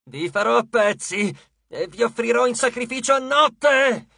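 high shouted goddess threat; grunted threat. A narrator's reading. Bleeding goddess threatens the demigods, grunting and shouting. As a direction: build a growled threat into a shout. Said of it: "grugnì".

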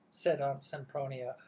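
usual speaking voice

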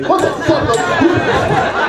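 a stretched, high, loud what the fuck; shocked outburst. Retold or imitated. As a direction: blurt it out in disbelief, slow and loud. Shocked retort to slur.

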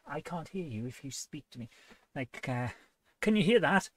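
ordinary talk, nothing marked

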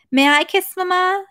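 put-on high, slow little child's question; child's request. A narrator's reading. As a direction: ask sweetly in a high small voice. First take, high child voice.

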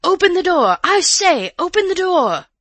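high, furious shouted demand; loud angry shout. A narrator's reading. Man shouting at the locked door, loud and angry. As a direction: shout angrily to be let in. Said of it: "it was shouting".

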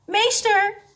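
high, loud call for attention. A narrator's reading. Mrs Rozenboom calling the instructor, loud. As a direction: shout for teacher like a pupil. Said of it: "riep mevrouw Rozenboom".

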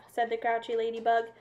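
ordinary delivery, nothing marked

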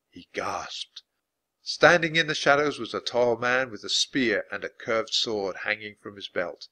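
plain reading voice

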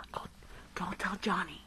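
normal talking voice

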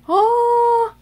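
high, loud yelp; a sudden cry of surprise; startled "Oh!". Retold or imitated. Thomas pulling trucks, high startled yelp. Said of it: "And then Thomas like".

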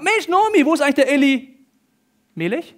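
very high, loud nosy exclaiming; acted excited greeting. Townswomen quizzing returned Nomi, acted excited greeting. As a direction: pounce with an eager question, hammed-up.

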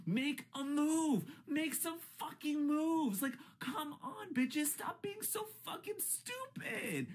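high, drawn-out furious yelling; exasperated screaming. Real, not acted. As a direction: scream it out in disbelief. Furious rant at houseguests.

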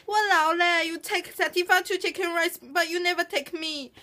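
whiny Singlish sulk. Shown as a demonstration. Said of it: "'cause I can speak Singlish".

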